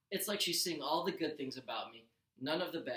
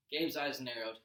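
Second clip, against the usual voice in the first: usual speaking voice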